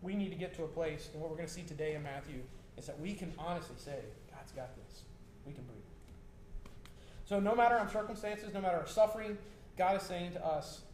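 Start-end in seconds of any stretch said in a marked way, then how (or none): 4.3-5.8 hushed, low and soft; soft, low, settling close; reassuring. Real, not acted. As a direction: let it out as a quiet reassurance. Honest quiet reassurance to congregation.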